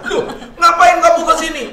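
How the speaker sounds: high, loud startled outburst; loud, high-pitched mock shock. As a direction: blurt it out as the shocked man caught at home. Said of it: "dia yang kaget".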